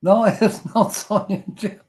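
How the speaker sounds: amused, laugh breaking into the denial. Real, not acted. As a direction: say it through a real laugh. Laughing at a repeated guess.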